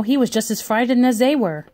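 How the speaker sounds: ordinary delivery, nothing marked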